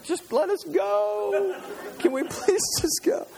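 very high, drawn-out mock whine; pleading kids. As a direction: imitate impatient kids begging to leave. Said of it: "my kids would be like".

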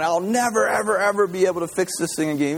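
high-pitched retold rant, hurried at the end; upset mock screaming. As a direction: act out a frantic complaint. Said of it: "he's just screaming. He's upset".

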